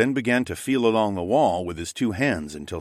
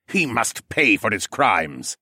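menacing regent; 3 semitones higher, 3 dB louder, slower than usual. Acted. Regent continues his threat.

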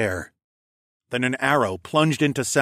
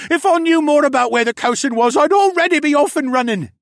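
high, loud whine; frantic whiny argument; sniveling captive; 8 dB louder than usual. A narrator's reading. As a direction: protest loudly in a coward's high voice. Sniveling captive argues back.

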